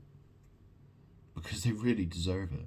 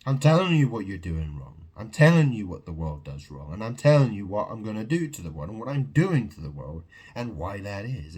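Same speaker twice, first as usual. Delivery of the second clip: louder, forceful declaration; loud rant; 6 dB louder than usual. Real, not acted. Warning the world what he'll do, loud. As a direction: drive each line at them.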